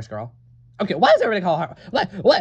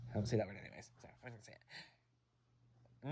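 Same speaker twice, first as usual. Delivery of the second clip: under breath; 7 semitones lower, 21 dB quieter than usual. Acted. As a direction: whisper it to yourself.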